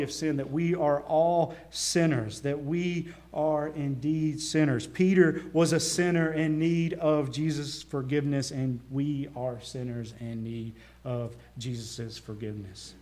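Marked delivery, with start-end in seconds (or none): none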